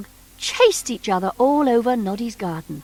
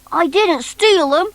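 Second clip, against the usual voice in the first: pleading; 7 semitones higher, 5 dB louder than usual. A narrator's reading. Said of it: "he pleaded".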